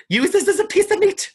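high, loud, camp delivery. Retold or imitated. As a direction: toss the line out high and camp. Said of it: "what did I do last week that you said was camp".

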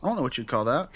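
normal talking voice

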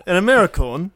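loud, high, posh offended Englishman; mock outrage. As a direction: play the outraged posh Brit. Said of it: "with my cut glass English accent".

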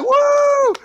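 very high, loud woo of applause; cheering whoop. Retold or imitated. Crew cheering as the captain pops back up, loud whoop. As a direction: cheer like a crowd that's relieved. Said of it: "then everyone's like".

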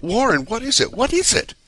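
high, loud, panicked calling; frantic shouted questions; terrified. A narrator's reading. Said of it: "Terrified, I continued to repeat".

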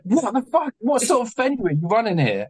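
high-pitched exasperated disbelief; squeaky-high retold indignation, not shouted; incredulous outrage. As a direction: re-enact being appalled, pitch soaring. Retold outrage at venue owner.

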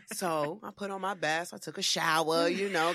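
usual speaking voice

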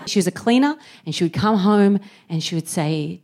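usual speaking voice

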